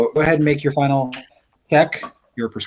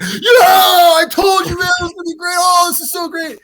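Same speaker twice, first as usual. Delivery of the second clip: high, loud whooping crewman; wild cheering; 6 dB louder than usual. Acted. Crew hearing there's free alcohol, wild high cheering. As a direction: shriek it in comic, overjoyed celebration.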